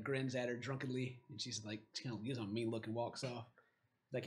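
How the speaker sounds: usual speaking voice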